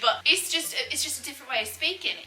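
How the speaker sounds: casual London English with dropped H's; everyday London accent. Shown as a demonstration. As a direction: loosen posh English into laid-back London speech. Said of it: "You don't speak so posh, you don't pronounce your H's".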